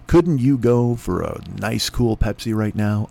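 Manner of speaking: deep, slow, smooth ad read; beverage ad voice. Pretend. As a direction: sell the soda like a TV spot. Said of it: "Let's try that".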